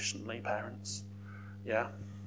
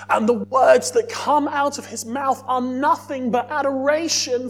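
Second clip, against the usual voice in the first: higher, louder fervent preaching; full-force preaching; 7 semitones higher, 6 dB louder than usual. Acted. Preacher telling the congregation about Zechariah's praise, fervent loud preaching. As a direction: proclaim it with rising fervour.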